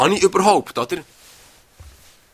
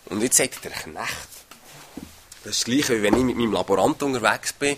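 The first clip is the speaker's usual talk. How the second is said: usual speaking voice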